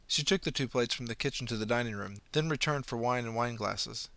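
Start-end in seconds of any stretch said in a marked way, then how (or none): none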